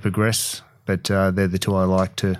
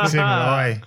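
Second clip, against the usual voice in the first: real chuckle through the words; amused, laughing as you talk; 4 semitones higher than usual. Real, not acted. Chuckling at his Robbie Savage joke.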